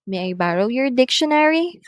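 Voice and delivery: quick, high question climbing to "dictionary"; rising intonation. Shown as a demonstration. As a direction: lift the end of "dictionary". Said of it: "are going up".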